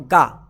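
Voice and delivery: low clipped ga; phonic g sound. Shown as a demonstration. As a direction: drop the voice onto गा. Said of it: "जी को क्या पढ़ते हैं".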